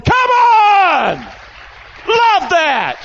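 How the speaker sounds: high, loud cheer from the pulpit; rallying shout. Real, not acted. Preacher cheering the men with hands up, rallying shout. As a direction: whoop it out to fire up the room.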